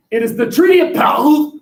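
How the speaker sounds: "Paris" as "Pah-lee", loud and high in a hammed-up French accent; mock French Paris. Shown as a demonstration. Teacher hams up French accent for the class. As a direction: belt "Treaty of Paris" in an over-the-top French accent. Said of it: "say the word Paris as if you're attempting to puke".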